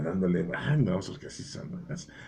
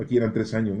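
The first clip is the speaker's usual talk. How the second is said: ordinary delivery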